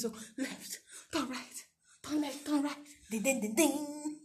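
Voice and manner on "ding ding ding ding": slow, higher sing-song ding noise; mock police signal. Mocking film actors playing police, sing-song dings. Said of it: "So they just sti-, keep doing this".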